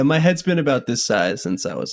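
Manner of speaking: ordinary talk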